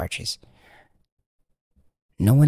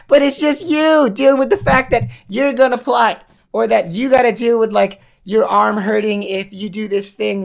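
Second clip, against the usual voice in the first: high, loud hammed-up doom voice; mock-sinister high villain; gleeful menacing figure; 13 semitones higher, 6 dB louder than usual. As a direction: taunt them like a cackling cartoon villain. Menacing character voice taunting listener.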